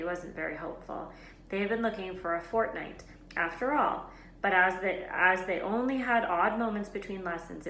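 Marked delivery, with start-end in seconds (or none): none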